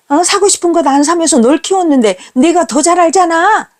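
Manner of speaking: mother crying out in hurt disbelief; near-screaming cry. Retold or imitated. Mother screaming back at her son, high and anguished. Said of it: "절규하다시피 소리쳤습니다".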